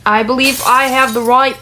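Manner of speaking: attempted New York accent, a bit strained; slightly strange, put-on New York accent; light New York accent. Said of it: "like, a light New York accent".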